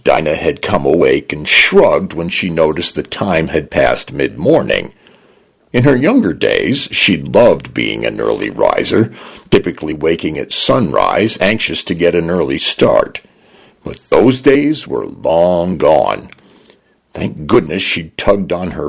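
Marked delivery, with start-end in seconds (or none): none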